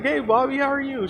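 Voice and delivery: high, soft girly mock voice; imitated girl's greeting. Girl escaping to greet Bobby, high imitated greeting. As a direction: greet a friend in a light, high voice.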